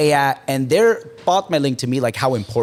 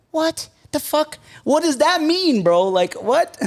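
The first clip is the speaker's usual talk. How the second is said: much higher, slow, strained disbelief; baffled outrage; 13 semitones higher, slower than usual. Retold or imitated. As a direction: act like the answer makes no sense at all. Told "but you're a man.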